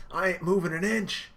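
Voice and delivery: high, loud refusal; defiant shout. Retold or imitated. Luffy shouting he won't budge. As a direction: dig in and shout it.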